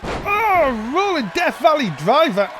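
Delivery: building ringside play-by-play; wrestling commentator. Real, not acted. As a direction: call the near fall, rising.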